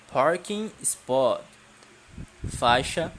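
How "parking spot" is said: slow careful model. Shown as a demonstration. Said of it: "Não estou ouvindo vocês repetirem".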